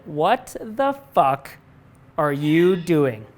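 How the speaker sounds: drawn out slowly, each word weighted; mild mock confrontation, slow and heavy; slow emphatic challenge. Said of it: "he pulled me aside and he said".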